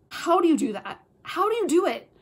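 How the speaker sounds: loud exasperated question; exasperated disbelief. Real, not acted. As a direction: throw up your hands at the book. Exasperated rant about the book.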